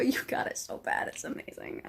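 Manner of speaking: hushed, unvoiced adoration; whispered fan swoon. Real, not acted. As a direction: whisper it fondly. Swooning over lovesick character.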